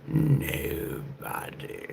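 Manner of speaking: high breathy whispered echo; high airy whisper, slow. Acted. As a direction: float it up in a whisper. Echoing own line in high voice.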